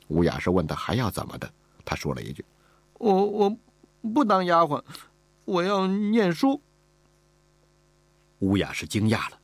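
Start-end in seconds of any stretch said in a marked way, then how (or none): 3.0-6.6 put-on high little girl's voice, stammering at first, then slow; a small girl daring to refuse. Servant girl refusing the mistress, timid but determined.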